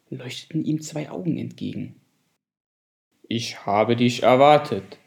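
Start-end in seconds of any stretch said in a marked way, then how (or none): 3.3-5.0 a hint of a deep, solemn octopus. Wise octopus greets the rainbow fish, lightly deep voice. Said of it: "mit tiefer Stimme".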